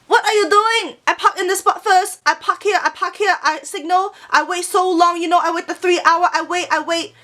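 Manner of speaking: put-on high-pitched yelling in a Chinese accent; heavy Chinese accent. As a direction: mimic an irate lady with a thick Chinese accent. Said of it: "very heavy Chinese accent".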